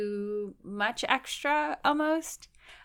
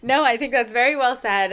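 laughing through words; 5 semitones higher, 7 dB louder than usual. Real, not acted. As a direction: say it while laughing. Laughing after compliment.

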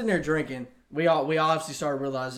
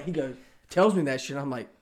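usual speaking voice

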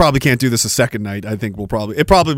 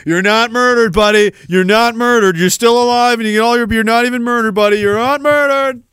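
mock exasperated reassurance; 7 semitones higher, 3 dB louder than usual. Acted reply to wailing victim.

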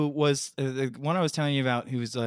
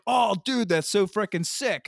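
higher-pitched imitated excitement; excited buddy; 7 semitones higher than usual. Host retells buddy's reaction to the reveal, excited. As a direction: imitate a thrilled friend.

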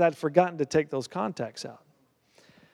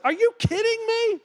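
can't believe the good news; amazed disbelief. Retold or imitated. Hearing contacts were found.

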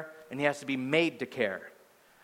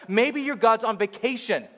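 higher-pitched retold taunt; mocking; 6 semitones higher, 3 dB louder than usual. Prophet taunting priests as they pray, mocking. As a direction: needle them with mock suggestions. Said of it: "he goes on to mock these guys".

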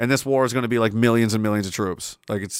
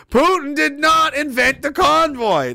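mock outrage; 13 semitones higher, 5 dB louder, slower than usual. Streamer mocking a conspiracy claim.

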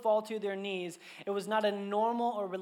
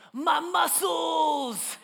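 high, loud, slow shout of my muscles; toddler boast; 8 semitones higher, 7 dB louder, much slower than usual. Retold or imitated. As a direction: crow it like a proud two-year-old. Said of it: "just goes".

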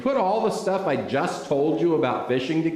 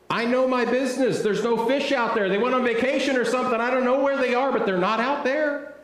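exasperated protest; 7 semitones higher than usual. Acted. Acts Simon arguing with Jesus.

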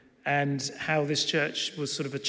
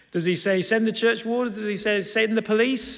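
high-pitched, rising list of absurd suggestions; raised, mock-incredulous asking; mock questioning; 6 semitones higher, 4 dB louder than usual. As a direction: pile up rhetorical questions. Mock guesses at what Nehemiah said.

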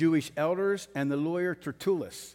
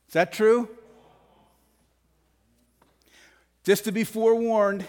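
preaching loud; 4 semitones higher, 5 dB louder than usual. Real, not acted. Preacher builds to full force.

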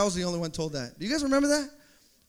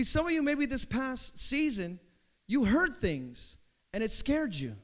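ordinary talk, nothing marked

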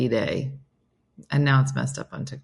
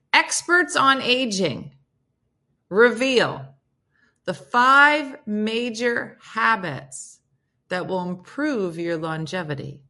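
dramatic headline read; 5 semitones higher, slower than usual. Shown as a demonstration. Vlogger reading the article headline dramatically to viewers. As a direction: announce each word like big news. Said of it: "reading headline dramatically".

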